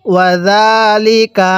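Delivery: slow ওয়া জালিকা with a long জা; drawn-out recitation. Shown as a demonstration. Teacher joins the syllables for students, slow recitation.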